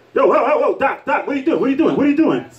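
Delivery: frantic prisoner. Retold or imitated. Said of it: "he's not like".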